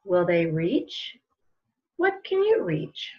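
slow, gentle picture-book read-aloud with long pauses; reading to children. Acted. Librarian reading a picture book to children at home, slow storytelling voice. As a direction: let each question hang for little listeners.

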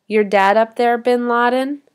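loud jeer rising at the end; dripping in derision. A narrator's reading. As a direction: taunt from behind with open scorn. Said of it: "It is dripping in derision".

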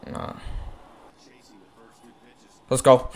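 ordinary talk, nothing marked

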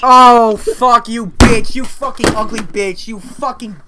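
duped and exploding, then trailing off; outraged insult. Acted. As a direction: curse them out for the trick. Finds out caller tricked him.

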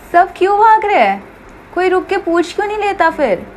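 high-pitched, baffled protest; exasperated, pitched well up; exasperated questioning. Acted. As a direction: ask it high, in disbelief. Companion baffled by the mindless race.

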